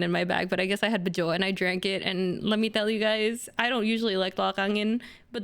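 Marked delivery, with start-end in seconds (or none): none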